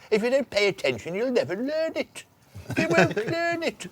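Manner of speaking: high, scolding schoolteacher voice; hammed-up stern teacher warning; physics teacher impression. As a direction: tell off a class that isn't listening. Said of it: "Mister Dwyer".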